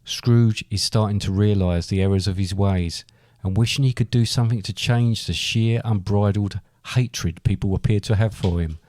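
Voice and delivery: usual speaking voice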